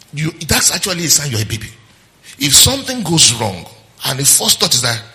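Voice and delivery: ordinary talk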